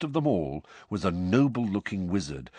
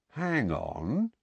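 slow; 7 semitones higher, 4 dB quieter than usual. Acted. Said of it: "said the wizard slowly".

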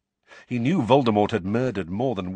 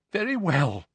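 voice cracking; 9 semitones higher than usual. A narrator's reading. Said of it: "her voice cracking".

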